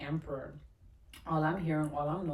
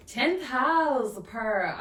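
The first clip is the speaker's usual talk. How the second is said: tenth house burst out, higher and louder; excited exclaim; 7 semitones higher, 8 dB louder, much slower than usual. Real, not acted. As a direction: announce the card with a thrill. Excited at card drawn.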